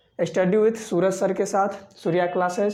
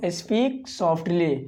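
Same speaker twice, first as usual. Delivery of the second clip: very slow, carefully drawn out; teacher saying it slowly for students to copy; slow model phrase; much slower than usual. Shown as a demonstration. Teacher modelling the English phrase for the class, slowly. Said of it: "धीमे बोलो".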